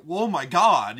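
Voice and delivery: loud startled oh my God; shocked gasp. Retold or imitated. Viewer reacting to the knife with a loud shocked gasp. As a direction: blurt it out in shock. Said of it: "and you're like".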